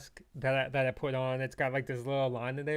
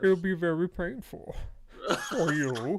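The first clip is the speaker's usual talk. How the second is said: Bane; 4 semitones higher, slower than usual. Retold or imitated. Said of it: "As long as we talk like Bane".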